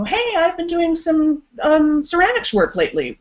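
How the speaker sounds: chirpy small talk. Pretend. Imagined coworker sharing hobby.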